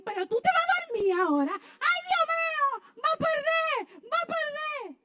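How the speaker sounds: high, loud panicked yell; frantic shout. Retold or imitated. Wife yelling at husband who's going to sleep during the fight, frantic. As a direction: yell in mock despair at him.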